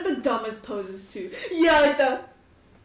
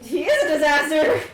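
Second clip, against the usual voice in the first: high, loud outburst about a hopeless man; loud exclamation; 5 semitones higher, 8 dB louder than usual. Acted. Player exclaims over what a disaster the guy is, loudly. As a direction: burst out with it, pitched up. Acted exclamation after a calm lead-in.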